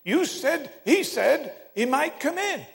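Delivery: put-on high-pitched child explaining; little boy. Little boy telling the preacher his mistake, high child's voice. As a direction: spell it out like an insistent child. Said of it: "one little boy".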